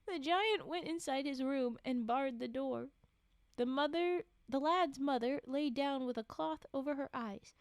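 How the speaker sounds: steady read-aloud storytelling voice; measured storybook reading; story narration. A narrator's reading. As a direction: read the tale to a listener.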